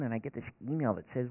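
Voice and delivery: normal talking voice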